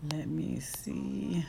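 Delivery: slow whisper to herself. Real, not acted. Searching her phone on the live, whispering to herself. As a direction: whisper it slowly while searching.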